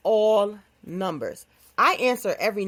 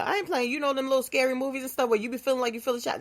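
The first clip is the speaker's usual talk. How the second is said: ordinary talk, nothing marked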